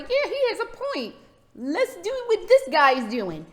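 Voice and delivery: a hint of an eager convert; mock crowd agreement.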